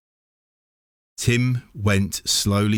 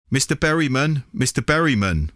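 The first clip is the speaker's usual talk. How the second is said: shouting; 7 semitones higher, faster than usual. A narrator's reading. Boy calling to his neighbour, a lively read-aloud shout. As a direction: call out to him from a distance. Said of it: "shouted Tim".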